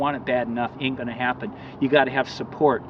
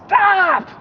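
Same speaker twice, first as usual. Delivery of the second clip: high, loud desperate yell; a sudden, piercing cry to halt the bus; shout; 7 dB louder than usual. Retold or imitated. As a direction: throw your whole body into the stop. Stepping in front of bus.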